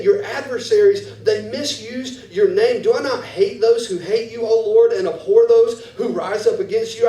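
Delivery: loud, high, impassioned pleading; fervent prayer. Acted. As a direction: pour out anger to God. Fervent prayer against enemies.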